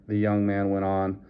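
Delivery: usual speaking voice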